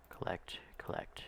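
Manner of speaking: whispered under the breath. Real, not acted. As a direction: whisper it to yourself. Chanting while collecting cargo.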